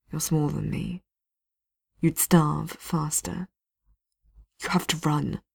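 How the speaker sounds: steady despite the pain; hint of a wounded man. Blackwall persuading Vivian to escape, quietly pained.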